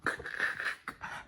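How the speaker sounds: soft unvoiced laugh; breathy laugh. Real, not acted. Reacting to her poem gift with breathy laughter. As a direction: let out a silent, breathy laugh.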